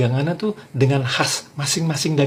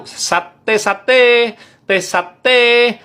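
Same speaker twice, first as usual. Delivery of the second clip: high, loud "teh sate" cry; standard satay-seller call; 8 semitones higher, 5 dB louder, much slower than usual. Shown as a demonstration. Madurese satay vendor everyone recognises, high loud call. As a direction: ring out the last syllable. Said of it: "Mereka udah pada tahu".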